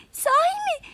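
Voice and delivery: high-pitched, delighted cry; joyful exclamation. A narrator's reading. Little creature hearing it may stay, joyful cry. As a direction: burst out in happy disbelief. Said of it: "diye bağırmış".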